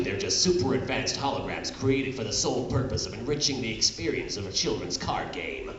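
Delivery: ordinary talk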